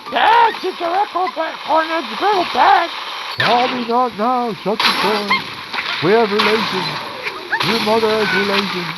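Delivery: put-on whiny kid son. Son pleading with dad about the griddle, high whiny voice. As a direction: squeak at dad like a panicked son.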